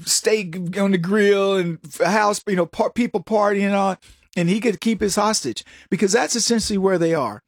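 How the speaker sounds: normal talking voice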